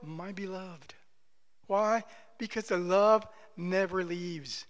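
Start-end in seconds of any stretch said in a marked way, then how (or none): 0.0-0.9 much softer, loving and gentle; tender. Retold or imitated. The Spirit answers grieving children: soft, tender. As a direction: breathe it out with fatherly love. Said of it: "his response is always".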